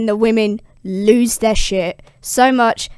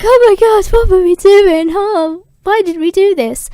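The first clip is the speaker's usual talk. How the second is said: much higher, loud, panicked cry; ashamed panic; 9 semitones higher, 5 dB louder than usual. Retold or imitated. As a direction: squeal it in mortified panic. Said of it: "women run off ashamed".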